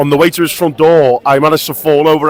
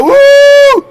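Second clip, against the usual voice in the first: excited whoop; 8 dB louder than usual. Real, not acted. Toasting the Underberg off.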